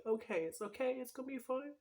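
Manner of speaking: high, fragile, close to crying; tearful self-reassurance. Real, not acted. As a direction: voice rising high as tears well up. Grieving, voices reassuring self-talk.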